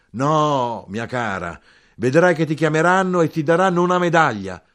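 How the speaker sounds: high, loud, emphatic cry. A narrator's reading. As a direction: shout it out in triumphant insistence. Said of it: "Più forte gridai".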